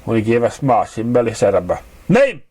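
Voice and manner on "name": put-on clipped high Manhattan accent on a single word; a bored New York clerk barking the question in Manhattan accent; thick Manhattan accent. A narrator's reading. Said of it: "in a thick Manhattan accent".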